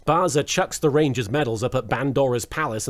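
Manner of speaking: ordinary talk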